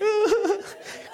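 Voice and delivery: very high-pitched mock wail; imitated crying. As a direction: fake a high, wordless sob.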